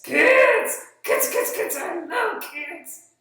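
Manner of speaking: high, loud, eager puppy-like puppet; excited puppet voice. Acted. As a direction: burst in like a puppy thrilled to see children. Puppet Rufus greets the kids.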